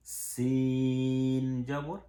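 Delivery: সিন জবর stretched very slowly, vowel held long; drawn-out recitation. Shown as a demonstration. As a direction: sing out সিন জবর slowly, holding the sound. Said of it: "সবগুলোর পার্থক্য করতে হবে".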